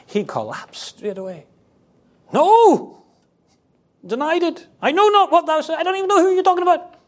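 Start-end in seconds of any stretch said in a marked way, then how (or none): 2.3-3.0 high, loud, drawn-out No; emphatic denial. Acted. Preacher acts Peter collapsing before the girl, emphatic denial. 4.8-6.9 high-pitched, hurried protest; protesting denial. Acted. Preacher acts Peter denying it to the girl, protesting denial.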